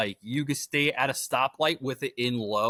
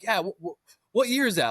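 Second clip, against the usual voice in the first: mock dopey guy, pitched up high; clueless guy voice; 9 semitones higher than usual. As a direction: play the confused bystander, voice climbing. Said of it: "He's like".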